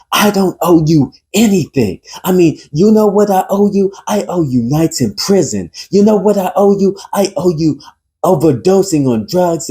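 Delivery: high-pitched, heated tirade; acted furious outburst, pitched high; fit of rage. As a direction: rage through the list of grievances, voice well up. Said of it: "a fit of rage".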